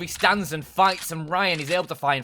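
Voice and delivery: excited play-by-play. Real, not acted. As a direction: lean in and raise the stakes as the fight unfolds. Caster hyping a multi-kill.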